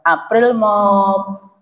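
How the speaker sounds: drawn-out, slow teasing "April Mop"; mock-triumphant tease; gleeful reveal. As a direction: savour the punchline slowly. Husband reveals joke.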